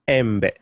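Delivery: "embe" said em-beh, short e sounds; Swahili e vowel. Shown as a demonstration. Teacher modelling the Swahili word for mango. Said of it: "Say that again".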